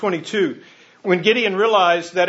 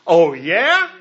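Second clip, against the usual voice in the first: scoffing, louder "Oh, yeah?" as doubting Gideon; sarcastic Gideon; 7 dB louder than usual. Retold or imitated. Gideon scoffs at the angel's greeting, sarcastic and disbelieving. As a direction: throw it back at the angel with disbelief. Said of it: "He says".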